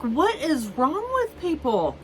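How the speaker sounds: high, stunned rhetorical question; exasperated disbelief. Retold or imitated. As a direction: throw it up high in outrage. Exasperated at guests.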